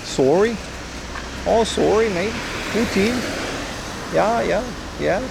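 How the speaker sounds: high, rising mock Canadian "sorry, mate"; mock Canadian accent. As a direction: ham up the Canadian apology. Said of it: "my Canadian accent".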